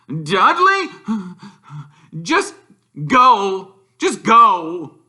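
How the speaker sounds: high, loud, gasping dismissal; breathless uncle. A narrator's reading. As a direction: wave him off while winded. Vernon out of breath.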